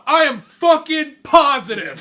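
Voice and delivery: high, slow, drawn-out mock assurance; smug certainty. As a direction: play it dead sure and smug.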